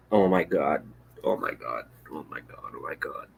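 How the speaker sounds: groaning fading to a whisper; despairing fade. Real, not acted. Picturing Warren as president, fading despair.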